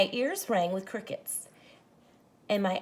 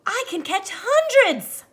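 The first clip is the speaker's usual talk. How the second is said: a much higher, louder, slow shout of joy; joyful shout; 13 semitones higher, 9 dB louder, slower than usual. Acted. The boy shouts his joy holding the glowing jar, joyful shout. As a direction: burst out with it. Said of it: "and I shouted".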